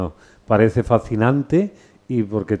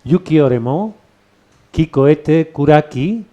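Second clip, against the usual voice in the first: slow, higher, lilting Japanese verse; slow haiku recitation; 4 semitones higher, 4 dB louder, slower than usual. Shown as a demonstration. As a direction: recite the poem slowly and clearly. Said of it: "repítemelo otra vez".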